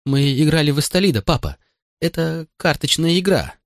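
put-on high child's voice, mildly tearful; understated sobs in a boy's reply; sobbing child. A narrator's reading. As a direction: explain to Dad while upset. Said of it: "Грудь ребёнка вздымалась от рыданий".

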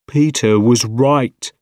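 slow, heavy, emphatic admission; regretful scientist. A narrator's reading. Scientist alone admitting failure, slow and regretful. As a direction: confess it slowly and forcefully.

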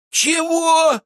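very high startled shout; shocked squeak. A narrator's reading. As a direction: leap up in disbelief. Fyrk jumps up.

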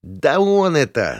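high, drawn-out wailing accusation; slow high-pitched yowl; Артурчик/character. A narrator's reading. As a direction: wail it to the gang, stretched and high. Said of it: "заголосил Артурчик".

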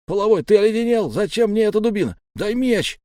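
loud, high-pitched outraged demand; fast, indignant outburst. A narrator's reading. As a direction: protest hotly at being handed junk. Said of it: "возмутился Галай".

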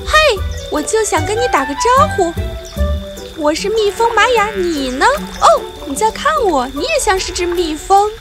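high, curious little bee. Acted. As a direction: chatter to her own reflection. Maya talks to reflection.

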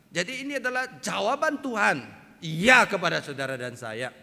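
high, loud pulpit proclamation; ringing sermon delivery, key words punched; forceful preaching. Real, not acted. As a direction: proclaim it boldly to the whole congregation.

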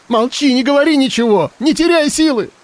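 high, tearful shouting; sobbing shout. Acted. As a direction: cry out while sobbing. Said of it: "Она рыдала и кричала на него в голос".

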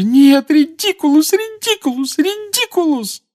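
very high, slow, sobbing repeated spell; desperate sobbing spells. Acted. Mrs Weasley desperately repeating the spell, sobbing. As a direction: fling the spell again and again in despair. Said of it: "простонала миссис Уизли".

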